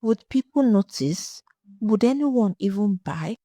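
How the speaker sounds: ordinary delivery, nothing marked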